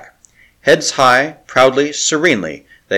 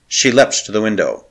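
ordinary delivery, nothing marked

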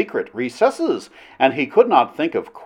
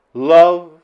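usual speaking voice

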